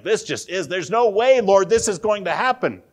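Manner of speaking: loud, high protesting outburst. Retold or imitated. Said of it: "It's like".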